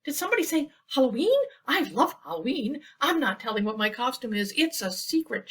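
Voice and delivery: high, fast, bubbly chatter; excited Thistle. Acted. Thistle bursting in, excited about Halloween. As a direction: gush it out all in a rush. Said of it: "she quacked".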